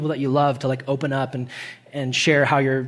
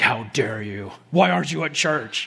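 lower, mock-indignant divine scold; hammed-up disapproving God; a hint of a stern heavenly father; 3 semitones lower than usual. Pastor voices God scolding surfer.

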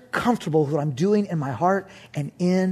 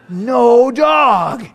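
louder, higher triumphant shout; 4 semitones higher, 8 dB louder than usual. Acted. Preacher shouting his joy to the congregation, loud. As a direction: crow it to the crowd.

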